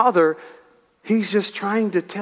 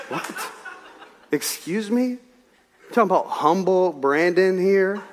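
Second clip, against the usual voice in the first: mock offended; 2 semitones higher, slower than usual. Preacher reacting to being called stubborn, mock-offended disbelief. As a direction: act affronted by the very idea.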